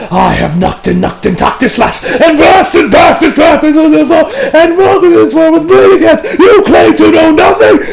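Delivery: high, shouted anime villain tirade; unhinged mock raving; ranting up a storm. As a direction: rant it in hammed-up fury, voice climbing. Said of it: "the man's ranting up a storm".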